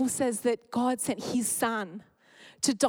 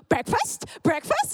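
put-on much higher, louder little-kid chant; toddler voice; 11 semitones higher, 4 dB louder than usual. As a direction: chirp it like an eager toddler. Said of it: "And then Bear comes in".

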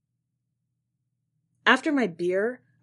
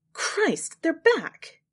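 almost relieved; 9 semitones higher, 4 dB louder, much slower than usual. Acted. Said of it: "She sounded almost relieved".

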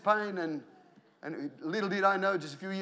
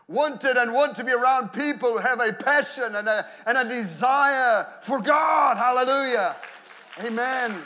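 loud, raised voice at full preaching force; impassioned preaching; 6 semitones higher, 8 dB louder than usual. Real, not acted. Preacher to his congregation about passion for God, full-force preaching. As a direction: preach it out, stretching the big words.

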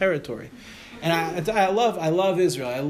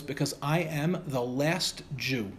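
very slow, low-key and solemn; grave revelation; 3 semitones lower, 5 dB quieter, much slower than usual. Retold or imitated. Student explains why he must live in Israel, gravely. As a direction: weigh each word slowly, softly.

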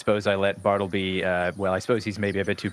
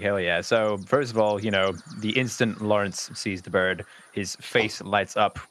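ordinary talk, nothing marked

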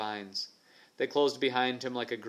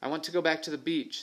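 put-on higher, pleading boyish voice; scared boy; 6 semitones higher, faster than usual.

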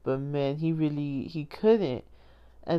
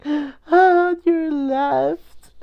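high, loud, drawn-out gushing; overwhelmed fangirl squeal; 10 semitones higher, 8 dB louder, much slower than usual. Retold or imitated. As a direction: squeal the retold line, stretching it out. Fan gushing over beloved movie moment.

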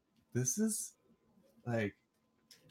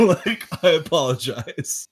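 laughing; 6 semitones higher, 11 dB louder than usual. Real, not acted. Still laughing, apologizing.

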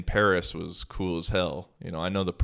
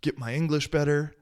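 quoting teammate; 7 semitones higher, faster than usual. Retold or imitated. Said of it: "he's like".

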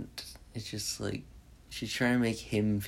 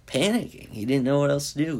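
worked up; 5 semitones higher, 3 dB louder, faster than usual. Real, not acted. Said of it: "speaker gets worked up defending him".

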